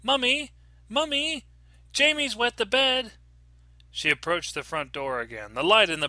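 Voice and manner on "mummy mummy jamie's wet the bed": put-on high-pitched little girl's call; child calling out. A narrator's reading. Daughter to mother from upstairs, calling out. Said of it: "she heard Poppy calling out".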